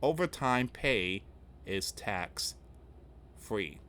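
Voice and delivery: very slow, spaced-out delivery; slowly. Shown as a demonstration. Host repeats the tax news for viewers, slowly and deliberately. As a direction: draw out each word, pausing before "is tax-free". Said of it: "I gotta say that again slowly".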